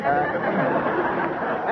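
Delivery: quick breathy chuckle; short laugh. Real, not acted. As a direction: laugh at your own story. [name] laughs mid-story.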